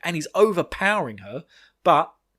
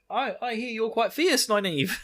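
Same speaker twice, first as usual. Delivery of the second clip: imitating Amyrlin; 8 semitones higher than usual. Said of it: "she's even like".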